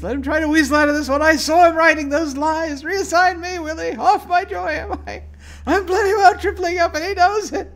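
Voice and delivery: high, loud, fast unhinged rant; manic shouting. Acted. Gamer voicing the diary's final unhinged entry, shouting. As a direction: scream it like he's snapping.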